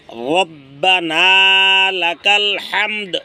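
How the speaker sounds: রব্বানা with a thick, heavy র, said slowly; correct recitation. Shown as a demonstration. Teacher showing the heavy র in রব্বানা, slow. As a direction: stretch রব্বানা লাকাল হামদ slowly with a heavy র.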